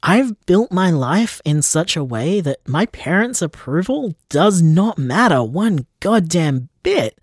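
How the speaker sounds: ordinary delivery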